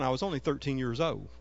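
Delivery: ordinary delivery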